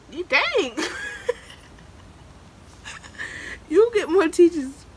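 breaking into high laughter mid-sentence; can't get the words out for laughing; real giggling through the line; laughing fit. Real, not acted.

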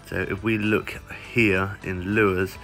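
normal talking voice

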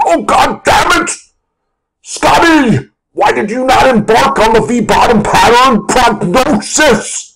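high, strained, drawn-out furious wail; over-acted high-pitched tirade; mock cursing meltdown. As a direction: rage at Scotty in a hammed-up strangled voice. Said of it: "instead of being like".

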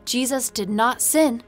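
ordinary delivery

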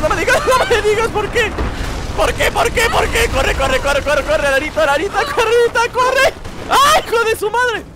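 gritos muy agudos y fuertes; pánico real. Real, not acted. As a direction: grita atropelladamente, urgiendo a Lara a huir. Huye de un derrumbe repentino. (gritos agudos de pánico)

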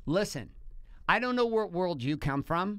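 very slow, each word laid down; slow firm quote. Retold or imitated. Husband quoting wife's money ultimatum, slow and firm. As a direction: spell out the ultimatum slowly. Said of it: "She says".

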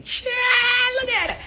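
loud, very high gushing; retold excited exclamation. Reacting to the shh emojis, loud high squeal. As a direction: squeal it like juicy gossip.

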